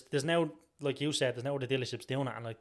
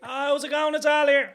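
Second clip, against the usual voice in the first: high, loud, bright greeting; Al's tagline voice; 13 semitones higher, 11 dB louder, slower than usual. Retold or imitated. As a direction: belt it out like a TV ad. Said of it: "Alan Lewis up in Dublin".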